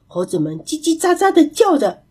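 usual speaking voice

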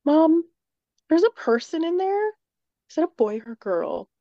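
put-on high curious kid calling mom; daughter's voice. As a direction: imitate the daughter calling out, then asking slowly. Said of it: "she was like".

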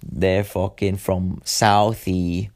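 slow, hammed-up Southie Boston accent; a self-mocking, overdone Boston accent; bad Boston accent. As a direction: lay on a clumsy Boston accent, dragging the words. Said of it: "Sorry, fucking horrible accent".

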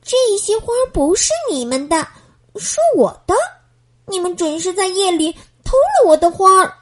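put-on a cross little girl, mild anger; angry. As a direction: scold the boys for stealing. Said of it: "她生气地说".

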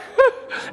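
high breathy laugh at his own story; laughing, almost crying; burst of laughter. Real, not acted. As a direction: crack up laughing.

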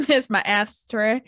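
usual speaking voice

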